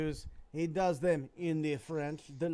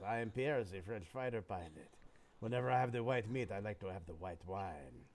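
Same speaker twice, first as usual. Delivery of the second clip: low, suave mock French accent, sinking lower at the end; hammed-up French accent; 5 semitones lower, 5 dB quieter than usual. Joke teller voices Pierre answering the woman, mock French accent. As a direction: play a smug French pilot in a thick French accent. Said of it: "I'm gonna leave you guys with a French joke".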